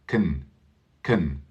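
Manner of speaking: "can" reduced to a quick weak kn, said softly; relaxed spoken "can". Shown as a demonstration. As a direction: swallow the vowel in "can". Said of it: "when we are speaking, a lot of people say can".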